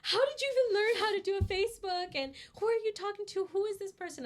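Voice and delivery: high, loud laughing scolding mom; imitating her horrified mom scolding her; mock angry mom. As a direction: shriek it like a horrified mother. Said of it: "my mom was like".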